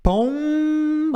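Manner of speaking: "Pom-" high with lips shut on m; closed-lip pom. Shown as a demonstration. Teacher showing lips closing for m. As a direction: hold the m, mouth closed. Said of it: "se você fizer".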